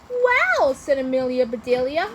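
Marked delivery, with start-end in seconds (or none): 0.1-0.7 high, loud burst of wonder; amazed exclamation. A narrator's reading. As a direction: exclaim it in awe, pitched up. Amazed arrival at science fair.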